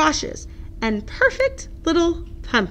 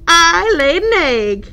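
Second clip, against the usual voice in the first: proud announcement; 5 semitones higher, 6 dB louder than usual. Acted. Duck proud joke.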